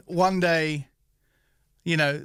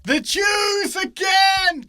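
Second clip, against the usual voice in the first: much higher, slow, shouted; mock outraged shout; 4 dB louder, much slower than usual. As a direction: ham up the outrage, drawn out.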